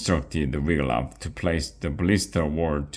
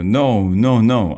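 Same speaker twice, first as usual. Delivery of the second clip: higher, louder flustered no no no; frantic denial; 6 semitones higher, 7 dB louder than usual. Acted. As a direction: hurry to take it back. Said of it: "cried Pierre".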